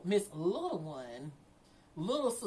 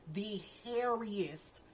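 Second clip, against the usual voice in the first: drawn out; 4 dB quieter, much slower than usual. Real, not acted. Describing mom's glare, slow drawn-out "hairiest". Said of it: "slowed repeat".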